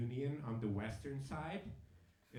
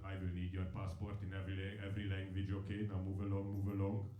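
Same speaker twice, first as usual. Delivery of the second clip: low, deadpan official voice; imitating an indifferent Hungarian official; unfazed passport officer; 5 semitones lower than usual. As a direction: recite it low and unmoved, like a border guard. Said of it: "without being fazed whatsoever".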